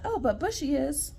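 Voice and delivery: ordinary talk, nothing marked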